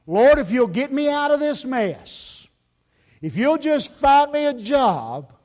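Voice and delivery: high, loud, slow plea; disaster praying. Acted. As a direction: cry out to God, acting desperate. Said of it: "the way our disaster praying sounds".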